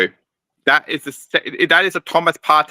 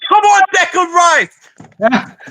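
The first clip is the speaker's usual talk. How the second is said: drawn-out celebratory goal yell, much higher; long goal yell; 5 dB louder, much slower than usual. Real, not acted. Live goal scored for Arsenal.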